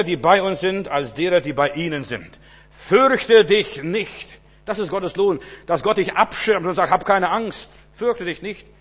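fervent preaching. Acted. Preacher telling David's story, loud fervent preaching. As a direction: preach it out with fire. Preaching God's words loudly.

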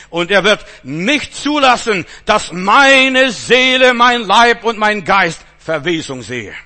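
very loud, slow, high-pitched sustained cry; soaring preaching climax. Acted. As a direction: stretch every word to the rafters.

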